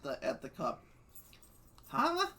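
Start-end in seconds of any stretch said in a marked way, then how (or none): 1.9-2.3 high startled huh; sudden surprised question. Acted. Character surprised in story.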